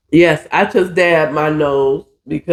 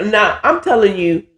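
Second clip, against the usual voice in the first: usual speaking voice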